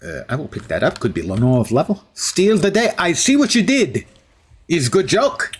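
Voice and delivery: hammed-up fake Russian accent; deliberately bad Russian accent. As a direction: play a steel hero with a mock Russian accent. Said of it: "bad Russian accent".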